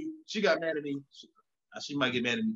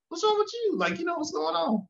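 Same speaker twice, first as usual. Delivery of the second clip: much higher, pleading what's wrong; retold exasperated question. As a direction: ask it high and baffled, half acting. Retold asking upset wife.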